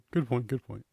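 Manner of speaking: mock-agreeing, dry and offhand; sarcastic agreement. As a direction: hand them a grudging good point. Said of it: "it's more of a sarcastic comment".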